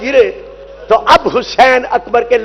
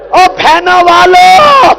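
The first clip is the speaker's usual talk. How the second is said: lamenting cry; 5 semitones higher, 4 dB louder, slower than usual. Acted. Reciter cries to mourners.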